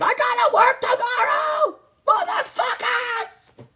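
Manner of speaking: very high, screaming furious dad; angry dad yell. Retold or imitated. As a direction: roar it like a dad losing it. Dad yelling at sleepover kids.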